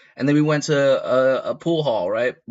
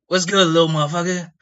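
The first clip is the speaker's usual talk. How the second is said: a touch higher, louder rough greeting; rowdy older guy; 6 semitones higher, 4 dB louder, faster than usual. Retold or imitated. The OG greets him from behind, rowdy and a bit loud. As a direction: hail the kid like an old-timer, a bit loud. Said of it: "I'm not doing a thrash impression".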